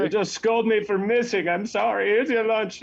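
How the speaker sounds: high, loud, tearful wail; bawling. Acted. Cabin boy wailing at the captain before running off, bawling. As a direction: cry it out and flee. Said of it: "bawling his eyes out".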